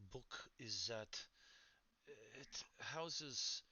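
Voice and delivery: put-on weak, lightly done French accent; a half-hearted French accent. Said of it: "Speaking with a very French accent, I don't do those very well".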